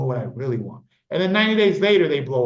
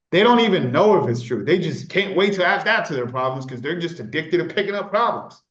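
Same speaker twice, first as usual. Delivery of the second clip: normal talking voice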